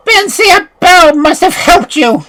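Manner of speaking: high, loud, slow furious witch cry; witch in rage. A narrator's reading.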